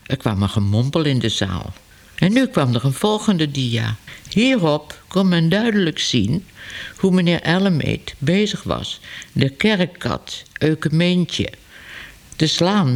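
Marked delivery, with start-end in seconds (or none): none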